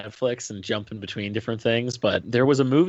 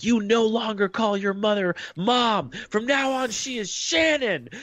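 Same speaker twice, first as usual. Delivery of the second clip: high, slow monster commanding; a teen's hormone monster laying down the law; puberty monster voice; 10 semitones higher, slower than usual. Retold or imitated. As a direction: loom over the girl in a high monster voice. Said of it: "the puberty monster for the girl is like".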